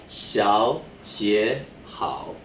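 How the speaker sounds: Xiaojie Hao spoken very slowly, each syllable drawn out; slow model reading. Shown as a demonstration. Teacher models Xiaojie Hao for students, very slowly. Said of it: "Now we have another Xiaojie Hao".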